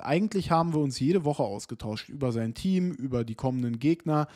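ordinary talk, nothing marked

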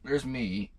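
ordinary talk

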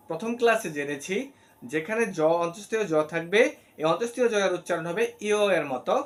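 usual speaking voice

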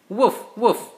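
high, loud playful woof woof; puppy bark. Acted. Dad reading to his kids, barking loudly as the puppy. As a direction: bark like an eager little puppy.

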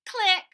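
high loud click noise; camera click sound. Pretend. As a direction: snap a click.

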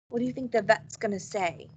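ordinary talk

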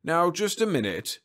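brisk. A narrator's reading. Supervisor interrupting an angry complainant, brisk. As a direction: cut in briskly. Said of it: "said Durden briskly".

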